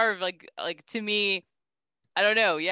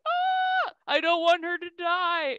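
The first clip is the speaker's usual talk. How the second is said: much higher, mock-panicked wail; panicked fan; 9 semitones higher, slower than usual. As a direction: squeal in fear for your favorite character. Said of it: "I was like".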